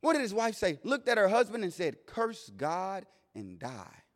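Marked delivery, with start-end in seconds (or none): 2.1-4.0 very soft, drawn-out words; hushed slow quote. Retold or imitated. Wife to suffering Job, hushed and slow. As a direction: let each word fall slowly and quietly.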